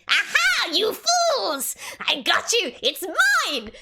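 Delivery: high, loud triumphant villain voice; shouted, high-pitched mad gloating; gloating Boggle. Acted. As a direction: crow over the stolen prize. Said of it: "then you hear coming from the ceiling".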